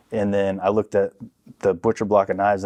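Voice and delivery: ordinary delivery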